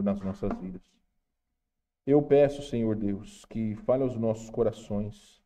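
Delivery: soft, low prayer voice. Acted. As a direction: pray quietly and humbly. Opening prayer.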